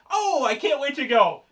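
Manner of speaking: high, loud mock delight; gleeful Joker. Joker picking up the mail, hammed-up glee.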